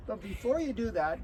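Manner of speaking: ordinary delivery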